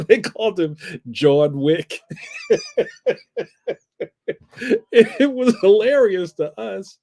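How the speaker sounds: laughing through the words; can barely get it out for laughing. Real, not acted. Laughing at the John Wick story.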